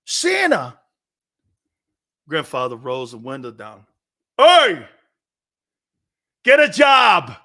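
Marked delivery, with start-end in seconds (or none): 0.0-0.8 put-on higher child's voice, thrilled to spot Santa; excited little kid. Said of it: "I go". 4.4-7.3 louder, higher gruff shout out the car window; grandpa's yell. Retold or imitated. Grandpa yells at bell-ringing Santa.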